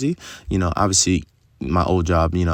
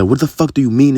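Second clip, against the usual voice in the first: higher and louder imitation of an irate boss; retold boss yelling; 7 semitones higher, 7 dB louder than usual. Boss swearing at the new salesman, imitated angry yell. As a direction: throw the boss's angry outburst back at them. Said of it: "this boss yelled at me".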